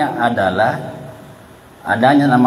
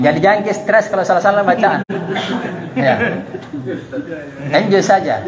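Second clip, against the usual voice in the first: laughing; 5 semitones higher than usual. Real, not acted. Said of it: "laughing encouragement".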